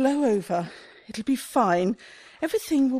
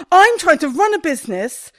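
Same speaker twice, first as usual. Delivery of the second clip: exasperated outburst; 4 semitones higher, 7 dB louder than usual. Acted. Shopkeeper ranting about traffic warden.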